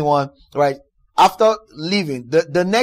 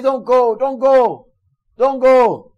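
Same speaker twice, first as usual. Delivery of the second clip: pleading cry; 5 semitones higher, 6 dB louder, slower than usual. Retold or imitated. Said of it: "the rest of the believers there were crying".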